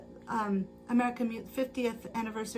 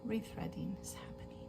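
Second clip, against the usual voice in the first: whispered narration, much softer; 5 semitones lower, 13 dB quieter than usual. Real, not acted. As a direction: murmur it like a hushed commentator. Whispered aside while rethreading.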